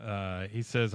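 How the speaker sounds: ordinary delivery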